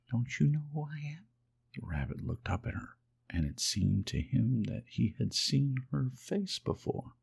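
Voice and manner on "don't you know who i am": gentle fairy voice. Acted. Fairy comforting the lonely toy rabbit, high and gentle. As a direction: speak as a kind fairy, lifted high.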